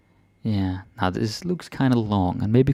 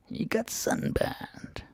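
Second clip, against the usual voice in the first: squeaky whisper; 14 semitones higher, 9 dB quieter, much slower than usual. Shown as a demonstration. High unvoiced translation.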